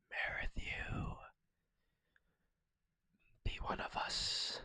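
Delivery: breathy, spooky whisper, mock cultish; eerie whisper. Streamer luring a lurker out of hiding, eerie whisper. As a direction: whisper him into joining the cult.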